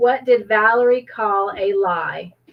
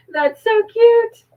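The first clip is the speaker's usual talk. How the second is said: high, loud gushing delight; 12 semitones higher, 5 dB louder than usual. Real, not acted. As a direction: gush over something adorable. Reacting to a sweet comment.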